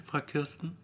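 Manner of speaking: ordinary delivery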